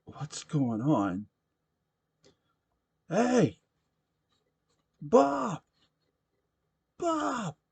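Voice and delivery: rising, high shouts across the bar; calling out for Bob. Acted.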